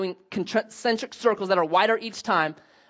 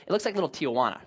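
ordinary talk, nothing marked

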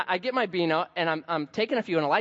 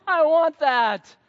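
put-on high, slow, louder childish demand; whiny child; 11 semitones higher, 8 dB louder, much slower than usual. Preacher mimicking his son demanding something, high and whiny. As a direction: whine it like a toddler. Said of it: "We're like Elijah John".

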